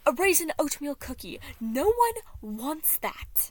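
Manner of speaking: loud, slowed last words; loud rant. Acted. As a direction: shout it, dragging out no one wants that. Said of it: "The prince gradually got louder".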